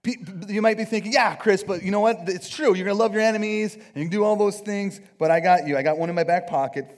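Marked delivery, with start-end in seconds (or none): 1.1-6.9 eager objector. Acted. Voicing a listener's eager objection.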